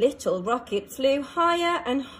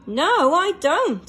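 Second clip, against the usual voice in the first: louder, slow, firm no; firm refusal; 4 semitones higher, 6 dB louder, slower than usual. A narrator's reading. Rocket refusing shuttle's race, slow firm no. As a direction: turn the offer down firmly. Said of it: "said Little Rocket".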